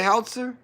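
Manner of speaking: high, doubtful "Howitzer?" as HOWT-ser; "Howitzer?" tried high, in two syllables; unsure pronunciation HOWITZER. Real, not acted. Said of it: "Is that how you say it?".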